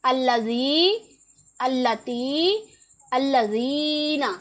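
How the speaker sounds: अल्लज़ी, अल्लती, अल्लज़ीना one at a time, long ee in each; अल्लज़ी, अल्लती, अल्लज़ीना as separate words, doubled l, stretched vowels; reading demonstration. Shown as a demonstration. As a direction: model अल्लज़ी, अल्लती, अल्लज़ीना slowly, holding the ee. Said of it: "इसे हम क्या पढ़ेंगे".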